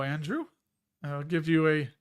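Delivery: usual speaking voice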